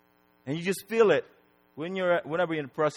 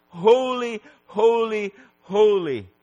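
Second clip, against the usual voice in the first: high, loud cry, easing downward; 5 semitones higher, 5 dB louder, slower than usual. Acted. Seraph's cry repeated for the holiness of God, crying out. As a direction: proclaim each word as a ringing cry. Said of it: "his cry of".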